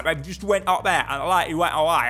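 loud, put-on Cockney; mock guards' chatter in broad Cockney; Cockney accent. Shown as a demonstration. Gamer showing how the guards would talk, mock Cockney accent. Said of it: "That's the Cockney accent for ya".

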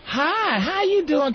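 high, lilting hello; put-on high-pitched friendliness; sweet greeting. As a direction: imitate an actress being friendly on camera. Acting out sweet on-camera greeting.

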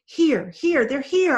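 high, loud urgent shout. Acted. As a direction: call them over the waves. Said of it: "Ivan shouted".

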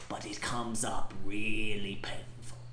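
hushed punchline; whispering. Acted. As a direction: whisper it like a secret. Mock profound whispered punchline.

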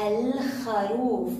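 "الخروف" slow, with the ل held; pronounced lam. Shown as a demonstration. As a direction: stretch الخروف, L clearly before a scraped kh. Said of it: "من حروف اللام القمرية".

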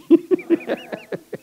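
high-pitched chuckle; real laugh rising high. Real, not acted. Preacher joking with congregation about being hijacked, laughing.

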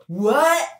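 high, rising yelp of disbelief; startled cartoon reaction; mock shock. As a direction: squeak it out, taken aback. Acting Spyro's shocked reply.

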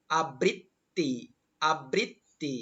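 আবৃত্তি slowly as "a-brit-ti"; correct pronunciation demo. Shown as a demonstration. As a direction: break আবৃত্তি into long, separate syllables. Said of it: "আবৃত্তি শব্দটির উচ্চারণ হচ্ছে".

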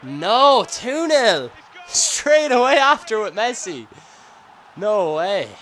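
high, loud disbelief; dismayed outcry. Real, not acted. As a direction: cry out at a second goal conceded. Second goal conceded.

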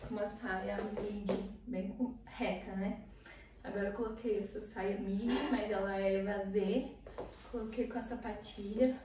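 usual speaking voice

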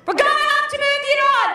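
high, loud, fast officious American bark; shouting agent. Retold or imitated. Agent to the woman on the pavement, high loud shouting order. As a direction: yell it like a jumpy federal agent. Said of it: "I don't know why you're shouting".

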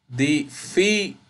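"Difícil" slow, split as di-FÍ-cil; teacher's slow breakdown of "Difícil"; syllable by syllable. Shown as a demonstration. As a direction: sound out "Difícil" with FÍ as the strong beat. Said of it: "no difícil".

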